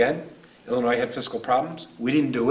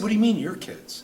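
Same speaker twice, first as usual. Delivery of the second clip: emotional disbelief; 9 semitones higher than usual. Real, not acted. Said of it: "I get emotional with this one".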